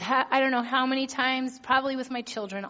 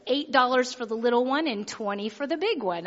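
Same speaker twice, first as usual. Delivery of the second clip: ordinary talk, nothing marked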